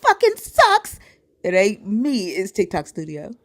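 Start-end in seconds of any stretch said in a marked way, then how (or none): 0.0-1.0 high, loud mock viewer; squeaky imitation of a complainer; mocking complaint. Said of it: "y'all come in here and be like".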